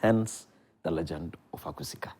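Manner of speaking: low hushed reveal of a play name; dramatic whisper. Acted. Guest announcing his new play's title in a dramatic whisper.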